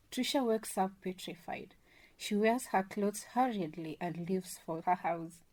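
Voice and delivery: normal talking voice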